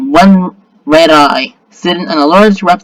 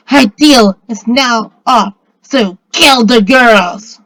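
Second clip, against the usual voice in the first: slow, raised, forceful order; commanding; 4 semitones higher, 4 dB louder, much slower than usual. A narrator's reading. Harpy orders the girls killed, slow raised command. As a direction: hand down the order slowly and emphatically. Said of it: "Flynn commands".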